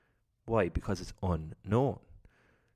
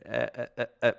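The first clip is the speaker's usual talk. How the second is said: nervous stammer, pitched way up; shaky, stammering question, much higher; shivering voice; 9 semitones higher, 6 dB louder than usual. Retold or imitated. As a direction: stutter it out like your nerves are shot. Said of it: "the voice just shivers out".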